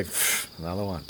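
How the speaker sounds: soft, low weary scoff; a deflated mock sigh; sighing dismissal. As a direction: scoff it off under your breath. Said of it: "you sigh and go".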